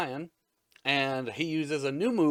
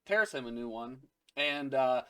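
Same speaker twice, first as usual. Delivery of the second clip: ordinary delivery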